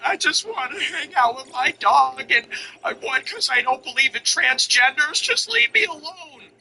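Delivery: high, plaintive parody with a drawn-out ending; mock plaintive singer. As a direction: mimic a sappy strumming performer begging to be left alone. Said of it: "slow it down for a minute".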